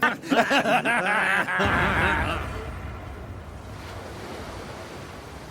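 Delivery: hearty laughter. Acted. Long friend laughter.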